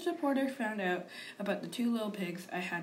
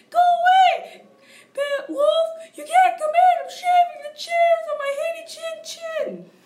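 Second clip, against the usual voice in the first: loud, far higher squeaky pig yell; 14 dB louder than usual. Retold or imitated. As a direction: shout him off in a high squeaky pig voice. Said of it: "He yelled back".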